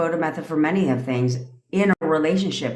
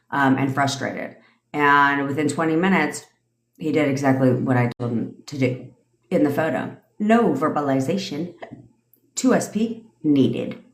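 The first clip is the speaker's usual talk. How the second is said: ordinary talk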